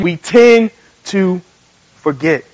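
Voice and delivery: "tend", "to", "forget" spaced out with pauses; slow repeat. Shown as a demonstration. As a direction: lead the crowd slowly, pausing before "forget". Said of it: "Say it again".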